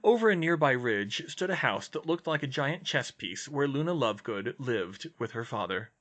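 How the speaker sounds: ordinary delivery, nothing marked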